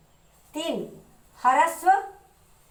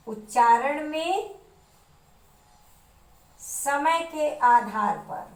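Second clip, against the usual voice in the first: slow dictation; slower than usual. Shown as a demonstration. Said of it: "teacher dictates heading slowly".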